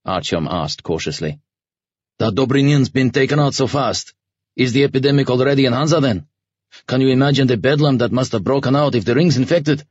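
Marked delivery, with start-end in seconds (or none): 2.2-9.8 gruff commander voice. A narrator's reading. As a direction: voice the commander, grim and worried. Commander speculates on epidemic.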